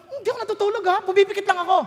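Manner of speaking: defensive denial. Retold or imitated. Caught sleeping, defensive retort.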